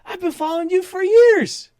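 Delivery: high, starstruck gushing fan; excited fan voice. Retold or imitated. Security guard gushing to the speaker, high and starstruck. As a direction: gush like you just met your hero. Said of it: "And he's like".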